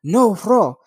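high, loud, bad German accent shout; attempted German accent. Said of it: "that doesn't sound fucking German".

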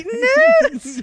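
loud, high, drawn-out squeal of disbelief; mock shock. Host mocking the author's reaction to Satanists, hammed-up shock. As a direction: wail it slowly in fake astonishment. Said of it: "and she's like".

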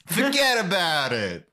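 exaggerated New Yorker catchphrase accent. Riffing on Frank Smith from New York, New York accent. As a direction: toss it out as a cartoon New Yorker. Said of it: "from New York".

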